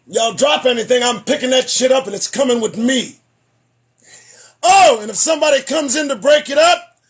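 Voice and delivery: shouting, half-joking; loud rant. Acted. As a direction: yell it at the crowd. Comic rant at onlookers.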